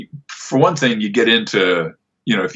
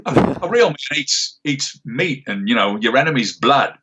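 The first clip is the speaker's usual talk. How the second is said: put-on higher, laid-back Australian accent as the gruff sniper; character Australian accent, pitched up and dry; Sniper's Aussie voice; 6 semitones higher than usual. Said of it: "to do the Aussie voice".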